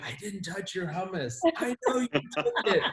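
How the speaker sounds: defensive dad. Retold or imitated. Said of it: "I'm like".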